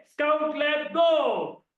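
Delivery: high, drawn-out call on "गो"; rousing classroom cue, stretched out. Shown as a demonstration. Teacher calls out the clap cue.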